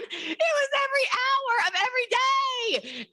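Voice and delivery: very high, stretched-out complaint; comic exasperation. Retold or imitated. Recruiter griping about constant questions, high comic exasperation.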